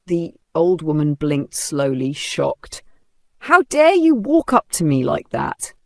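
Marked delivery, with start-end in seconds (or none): 3.4-5.7 sharp, high, indignant snap; angry snap. A narrator's reading. Woman snapping at an old lady who approached her. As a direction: cut her down with a sudden snap. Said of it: "Vanessa snapped".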